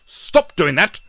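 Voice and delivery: loud, high, bossy hammed-up colonial order; mock British bully. Colonists ordering Africans about, loud mock bossiness. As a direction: bark it like a pompous imperial Brit. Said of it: "they beat them over the head with a Bible and saying".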